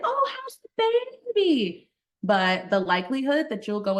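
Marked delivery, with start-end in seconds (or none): 0.0-1.9 high, bright fake-friendly question; fake cheerful. Doctor faking recognition, chirpy high question. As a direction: cover not knowing her with sweetness.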